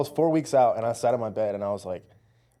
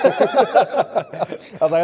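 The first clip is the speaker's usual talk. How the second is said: laughing; 10 semitones higher, 7 dB louder, much slower than usual. Real, not acted. Laughing at own food craving.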